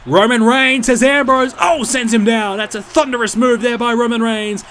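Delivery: loud, high excited play-by-play; excited commentary. Real, not acted. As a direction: call the big slam with a rising roar. Big move during live match call.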